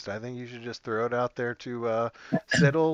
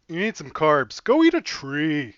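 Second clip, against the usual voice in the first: Evan; 5 semitones higher, 9 dB louder than usual. Retold or imitated. Player redoing his friend's inspirational speech, loud hammed-up impression. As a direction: belt it out like a mock motivational speech. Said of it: "inspirational speech".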